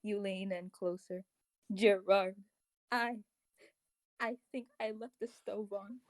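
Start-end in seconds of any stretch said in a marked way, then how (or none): none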